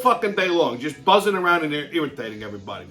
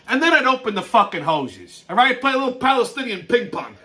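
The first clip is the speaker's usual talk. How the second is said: ordinary talk, nothing marked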